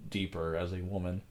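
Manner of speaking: ordinary delivery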